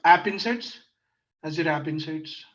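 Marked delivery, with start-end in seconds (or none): 0.0-0.8 repeated louder and clearer. Real, not acted. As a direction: say it again louder.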